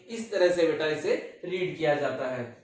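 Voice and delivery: usual speaking voice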